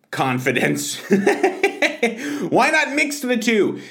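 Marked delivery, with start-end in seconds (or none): 1.1-3.8 laughing. Real, not acted. Laughing during ad.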